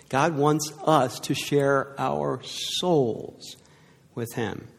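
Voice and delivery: slow, soft, heartfelt; tender and slow. Real, not acted. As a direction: lean in gently, let it land. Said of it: "slow tender emphasis".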